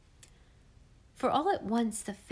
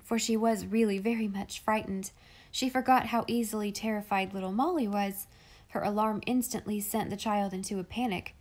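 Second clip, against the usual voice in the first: ordinary delivery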